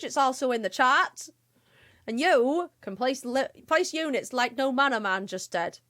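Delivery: put-on Somerset farmer accent; host the game in a West Country accent; amateur West Country English accent. Shown as a demonstration. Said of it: "I have to do an accent".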